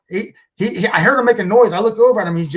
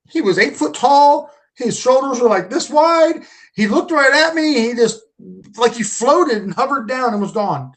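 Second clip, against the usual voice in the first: put-on higher voice, a shaken boy describing it; shaken son retold; 5 semitones higher than usual. Father voicing his shaken son's description, much higher. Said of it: "he's crying".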